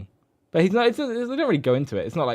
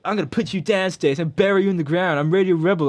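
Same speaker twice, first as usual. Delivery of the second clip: hammed-up menacing girl, higher; mock villain threat; 6 semitones higher, 4 dB louder than usual. Host mocking Tara as she turns on the bully, higher villain threat. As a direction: play her as a cartoon villain gloating. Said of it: "She does not say that".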